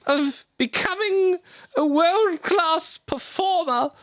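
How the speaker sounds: high, slow, grandiose proclamation; pompous drunk lord. Acted. Lord Damo announcing his grand exit, high and pompous. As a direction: play the puffed-up drunk nobleman.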